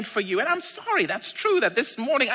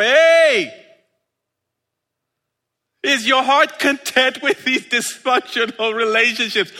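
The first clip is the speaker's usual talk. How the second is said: calling out; 5 semitones higher, 5 dB louder than usual. Acted. Jesus prodding the discontented about their lives, calling out. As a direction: hail someone far off, needling them. Acts Jesus hailing the listeners.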